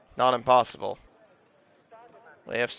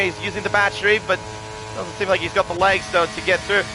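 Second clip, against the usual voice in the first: usual speaking voice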